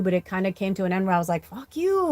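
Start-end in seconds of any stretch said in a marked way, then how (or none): 1.5-2.1 high, exasperated jab; mock exasperated fuck you. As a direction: fling it out at the family. Final outburst at family.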